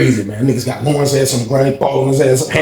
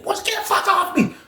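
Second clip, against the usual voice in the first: panicked yell; 6 dB quieter than usual. Retold or imitated. Victim yelling.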